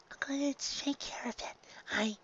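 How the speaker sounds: breathless whisper. Acted. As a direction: whisper it like a promise. Whispered line in preview.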